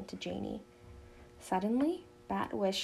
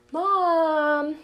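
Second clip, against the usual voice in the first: long, high, loud whining Mom; teen sister whining to mother for help; whine; 7 semitones higher, 13 dB louder than usual. A narrator's reading. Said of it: "whined Janey".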